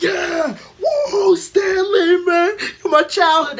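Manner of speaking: high, loud cheering while sobbing; mock crying cheer. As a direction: wail it like a fan in tears of joy. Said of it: "crying real tears and shit".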